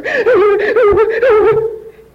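loud high wailing sobs, put on; mock boo-hoo wail in bursts; fake crying. Storming past her mother, loud fake sobbing. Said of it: "I made myself cry".

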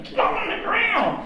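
a high, slow barked order, a mock cop yell; sergeant's shout. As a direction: bark it like a nervous sergeant with a gun. Sergeant with gun at steps.

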